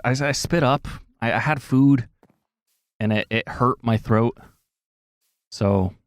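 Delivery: normal talking voice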